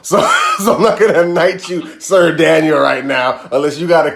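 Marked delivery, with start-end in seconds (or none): 0.1-2.8 laughing. Real, not acted.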